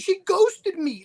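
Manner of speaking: high, slow mocking whine; mock him in a high drawn-out whine. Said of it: "He was like".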